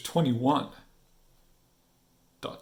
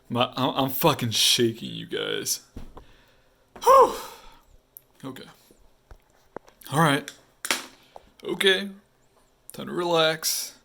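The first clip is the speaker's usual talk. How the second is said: ordinary delivery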